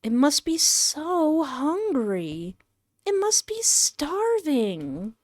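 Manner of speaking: high, drawn-out soft lament; mock pitying. A narrator's reading. Narrator guilt-tripping the player over a hungry cat, mock pity. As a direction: pile on the pity slowly, in a high voice.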